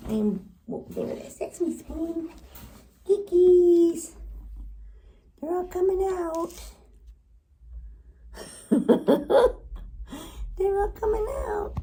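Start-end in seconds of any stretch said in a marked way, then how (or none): 3.1-6.5 high, loud coo; delighted squeal. Real, not acted. Owner seeing all the animals come out, delighted high coo. 7.7-10.5 high, loud giggle; delighted laugh. Real, not acted. Owner watching the animals come out, delighted giggle. 10.6-11.7 high-pitched excited coo; delighted squeal. Real, not acted. Owner thrilled the animals are coming out, high squeal.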